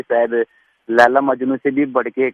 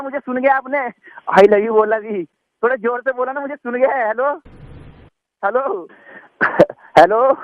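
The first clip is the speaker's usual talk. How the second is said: higher, eager calling into the phone; desperate pleading hellos; 10 semitones higher than usual. Acted. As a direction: plead for her to answer. Frantic calling into dead phone. (बेचैनी से hello पुकारते हुए)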